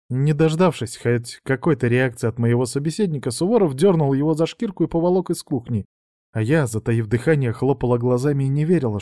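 usual speaking voice

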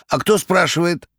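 high, rattling jester voice. A narrator's reading. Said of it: "дребезжащим голосом".

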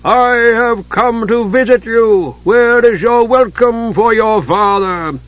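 high, loud, slow triumphant giant; giant's voice. A narrator's reading. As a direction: gloat like a freed giant.

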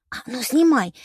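sharp command. A narrator's reading. Heroine orders knight.

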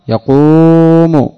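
يقوم stretched very slowly, the u of يقوم held long; drawn-out example. Shown as a demonstration. Teacher to students, drawing out يقوم to show the damma. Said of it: "المثال الثاني في يفعل".

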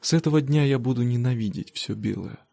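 ordinary delivery, nothing marked

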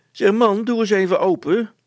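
high, loud woman's voice; calling wife. Acted. Wife calls husband through the door, high and loud.

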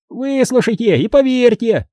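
high gnome voice repeating like an echo. A narrator's reading. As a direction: echo the plea high, stretching the stresses. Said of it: "словно эхо повторил Уэсли".

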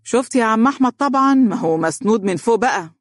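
high-pitched mocking aside; sarcastic. A narrator's reading. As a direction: sneer about a colleague to a third person. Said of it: "يقول بسخرية وهو ينظر لأحمد".

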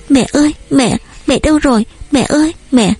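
put-on high little-girl voice calling for mother; child's wail. A narrator's reading. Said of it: "kêu mẹ rồi khóc òa lên".

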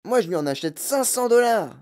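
high, excited lady's voice; outbidding cry. A narrator's reading. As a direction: call out a bigger offer. Lady outbidding the man excitedly.

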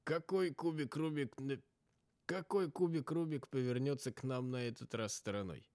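mildly wry character line; a hint of a joking companion; lightly voiced character. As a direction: toss off the quip in character. Character quips.

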